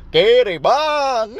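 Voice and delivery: high, slow, loud yell of the call to stop; shouting into the ear. Retold or imitated. Passenger yelling at a deaf driver to stop, loud shout. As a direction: bellow it right into the driver's ear. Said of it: "teriak di kupingnya".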